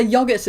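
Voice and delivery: "yogurt" said YOG-urt, short o as in dog. Shown as a demonstration. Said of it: "I know that it's yogurt".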